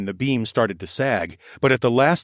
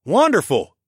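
higher, louder, delighted praise; enthusiastic; 8 semitones higher, 6 dB louder than usual. Acted. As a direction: beam with delight at the job. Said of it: "Bowden enthused".

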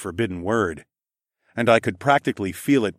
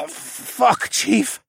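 high, strangled croak after coughing; 14 semitones higher than usual. A narrator's reading. Falcon man coughing up blood, croaking. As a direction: cough and choke the words out. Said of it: "the Falcon Man croaked out".

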